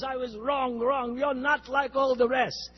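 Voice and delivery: put-on Russian accent. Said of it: "I'll imitate a little bit to him".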